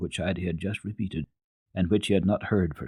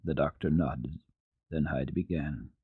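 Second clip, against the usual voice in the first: ordinary delivery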